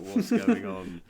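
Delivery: retold cinema crowd loudly asking what's happening; alarmed audience. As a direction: call out as if the whole crowd just spun round. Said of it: "a sea of heads turning, like".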